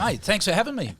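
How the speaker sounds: hearty greeting. Acted. Guest enters in character.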